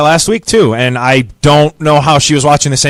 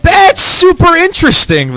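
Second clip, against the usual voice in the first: surprised; 13 semitones higher than usual. Real, not acted. Surprised reaction to bold prediction.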